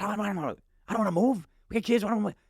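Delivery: wife refusing to move, mock upset and high; mildly mad wife. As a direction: play the annoyed wife pushing back. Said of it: "she's almost mad".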